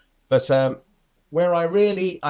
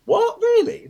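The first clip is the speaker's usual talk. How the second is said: surprised; 4 dB louder, slower than usual. Retold or imitated. Hearing Walter got no offers.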